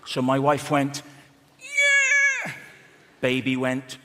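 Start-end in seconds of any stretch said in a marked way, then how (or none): 1.6-2.5 very high, loud squeal; short high-pitched pushing scream; imitated labour scream. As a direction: mimic a piercing birthing shriek. Wife's final push in labour.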